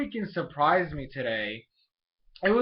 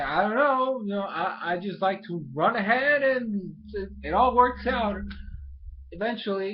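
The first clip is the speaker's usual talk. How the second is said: higher, breezy mock Ash voice; carefree retold quote; 5 semitones higher, 3 dB louder than usual. Ash answering friends who ask where he's going, carefree. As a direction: shrug it off in a higher voice. Acting out Ash running ahead.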